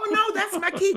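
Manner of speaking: high laughing exclaim. Real, not acted. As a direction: laugh out the protest. [name] laughs, delighted about key.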